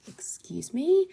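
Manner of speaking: whispered, slow disbelief; stunned whisper. Retold or imitated. Whispering back at the ticket man, stunned. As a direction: breathe it out in disbelief. Shocked at the 250 euro price.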